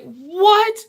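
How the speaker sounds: sharply high, loud disbelief; a shocked, high-pitched what; startled what. Retold or imitated. Nina reacts to the outburst.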